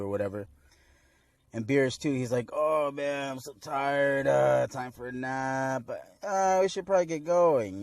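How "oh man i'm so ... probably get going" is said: hammed-up weary excuse, pitched higher; badly faked need for a nap; feigned tiredness. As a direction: put on a drawn-out show of being tired. Beerus feigns tiredness, acted.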